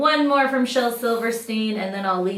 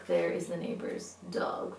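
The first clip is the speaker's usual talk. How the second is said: ordinary delivery, nothing marked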